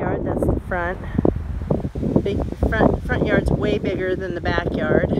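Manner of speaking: windy outdoor recording; wind on mic. Real, not acted. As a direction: wind gusting into the microphone. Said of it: "sorry for the wind".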